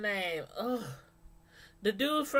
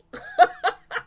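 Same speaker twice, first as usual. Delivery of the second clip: a high, loud burst of laughter; 7 dB louder than usual. Real, not acted. Laughing after quoting the mother's insult.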